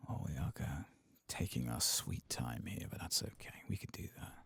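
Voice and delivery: ordinary talk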